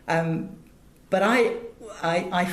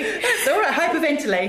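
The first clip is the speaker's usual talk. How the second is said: laughing; 11 semitones higher, 5 dB louder than usual. Real, not acted. Laughing after fan gush.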